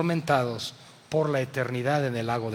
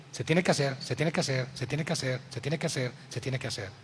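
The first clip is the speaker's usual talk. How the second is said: "se tiene que hacer" repeated, fading softer each time; mock rule-preaching; 5 semitones lower, 9 dB quieter, faster than usual. Preacher mocking legalistic churches, fading drone. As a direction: drone it like an empty rule, dying away. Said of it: "solo decir".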